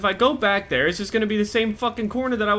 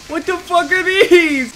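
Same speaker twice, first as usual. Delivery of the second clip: higher, louder, drawn-out question; horrified cry; 11 semitones higher, 8 dB louder, slower than usual. Real, not acted. Player finding hanging bodies, loud horrified cry. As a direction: wail it slowly in horror.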